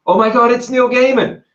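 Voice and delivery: high, loud starstruck gasp; excited fan. Retold or imitated. Fan spotting Neil Gaiman in the street, thrilled. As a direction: squeal it at the famous author. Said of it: "someone turns around and goes".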